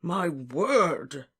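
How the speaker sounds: put-on puffing, flustered old professor; lightly voiced pompous professor; rumbling bluster. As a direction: bluster it out in dismay. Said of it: "when Slughorn rumbled into speech".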